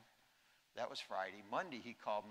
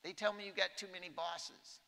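raised, brisk folksy president voice; imitating the president; 8 semitones higher than usual. President raises two problems with the general, brisk and raised. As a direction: play the president getting straight to business. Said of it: "And so he goes".

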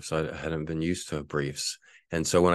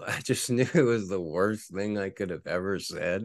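ordinary delivery

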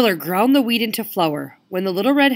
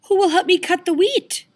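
high, louder hen voice; little red hen voice; 12 semitones higher, 5 dB louder than usual. Acted. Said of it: "she asked".

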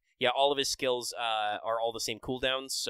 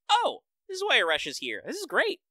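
mock delighted realisation; 13 semitones higher, 5 dB louder than usual. Podcaster recalling a pleasant story surprise, mock delighted. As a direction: act out pleasant surprise, pitched well up. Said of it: "and so it's like".

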